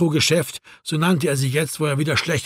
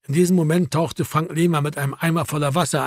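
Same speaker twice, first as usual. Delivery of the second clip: usual speaking voice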